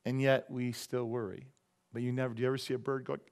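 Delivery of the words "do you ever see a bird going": ordinary talk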